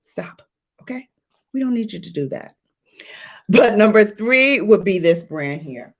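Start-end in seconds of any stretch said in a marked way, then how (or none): 0.0-2.5 quick, much softer teasing scold; half-whispered mock telling-off; hushed mock scolding. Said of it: "tell her".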